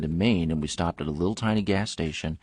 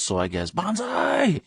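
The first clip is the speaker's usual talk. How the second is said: much higher, slow drawn-out "bonsai"; mock battle cry; 13 semitones higher, slower than usual. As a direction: play a hunter charging in with a sword.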